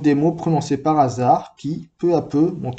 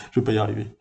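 laughing aside; 4 semitones lower, much faster than usual. Real, not acted. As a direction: laugh it off and rush through. Reader stumbles, laughs at herself.

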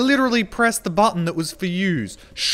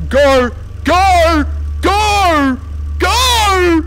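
loud cries climbing higher and longer; desperate shout; 10 semitones higher, 6 dB louder, much slower than usual. Real, not acted. Streamer in the last seconds, desperate climbing shout. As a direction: build to a desperate high yell.

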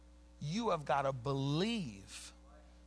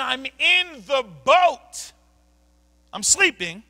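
slow, roaring rebuke, much louder and higher; Christ's booming rebuke; 8 semitones higher, 15 dB louder, slower than usual. Acted. Preacher booming Christ's words at doubting disciples, roaring and slow. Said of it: "Jesus is telling them".

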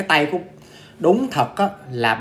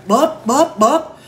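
high, punchy "bốp" smacking noises; imitated thumps; 8 semitones higher, 4 dB louder, much slower than usual. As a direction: imitate whacking a TV, sharp and high. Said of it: "Bà vỗ vậy là".

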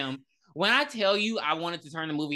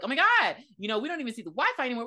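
much higher, fast frantic gabble; mock panic; 6 semitones higher, faster than usual. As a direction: rush it like a scared teen. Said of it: "They're like".

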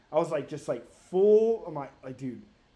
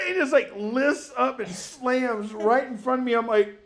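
ordinary delivery

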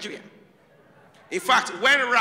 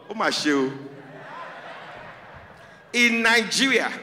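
usual speaking voice

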